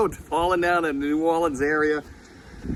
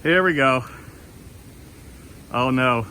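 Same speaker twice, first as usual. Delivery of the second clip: normal talking voice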